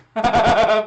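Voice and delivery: big loud burst of laughter. Real, not acted. Host cracking up at the close numbers, loud laugh. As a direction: crack up loudly mid-sentence. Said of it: "I know I'm laughing".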